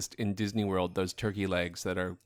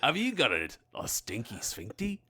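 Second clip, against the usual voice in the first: put-on Australian accent; 5 semitones higher, 6 dB louder than usual. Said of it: "Australian accent".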